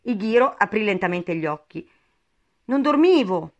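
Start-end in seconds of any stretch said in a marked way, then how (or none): 2.7-3.5 strangled, high-pitched protest; feeble choked voice. A narrator's reading. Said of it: "disse con voce debole e strozzata". (voce strozzata e acuta)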